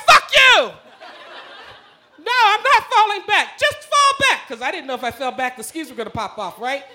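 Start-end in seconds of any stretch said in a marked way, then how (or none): 0.1-0.7 high loud yell with a laugh; shouted back. Retold or imitated. Yelling back while sliding. 2.2-4.5 high, laughing protest yelled; panicked yelling. Retold or imitated. Arguing while sliding downhill.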